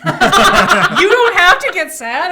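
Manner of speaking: loud, high, slow laughing retort. Real, not acted. Player reacting to Rod's death, laughing loudly. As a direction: laugh hard through the words.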